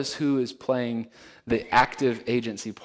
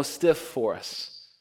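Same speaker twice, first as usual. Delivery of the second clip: ordinary delivery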